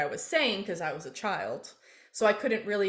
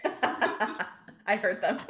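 laughing; 9 semitones higher, much slower than usual. Real, not acted. Laughing about barking dogs.

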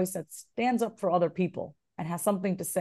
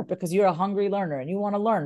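ordinary talk

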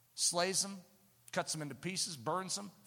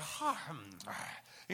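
sleepy hum; 5 semitones lower, 7 dB quieter than usual. Pretend. Sleepy guest settling.

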